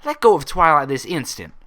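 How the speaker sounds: stern command. A narrator's reading. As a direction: order her off like an angry parent. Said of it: "The stern disapproval in the command".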